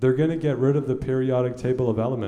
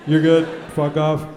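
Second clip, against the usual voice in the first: mock guard loudly waving you off; dismissive loud brush-off; 4 semitones higher, 6 dB louder than usual. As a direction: shove him through with a bark. Border scanner waving him through.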